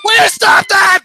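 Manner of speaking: hammed-up scolding line; reading the line in a silly loud voice; mock scolding. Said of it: "It says right here".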